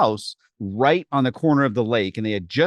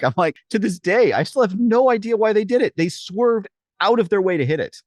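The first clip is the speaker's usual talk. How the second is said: ordinary talk, nothing marked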